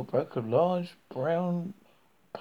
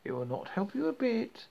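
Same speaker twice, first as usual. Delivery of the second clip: witch voice; 6 semitones higher than usual. A narrator's reading. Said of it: "the old witch called out".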